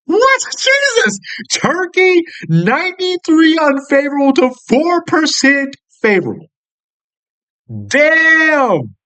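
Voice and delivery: very high-pitched disbelief; real shock. Real, not acted. Reacting to Turkey's lopsided poll result, shocked and astonished. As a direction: exclaim it, voice leaping up in astonishment.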